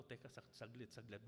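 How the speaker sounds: muttered under the breath, stopping short; hushed self-check. Retold or imitated. Preacher pausing mid-sermon-prep to question himself, hushed. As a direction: drop almost to a hush, catching yourself.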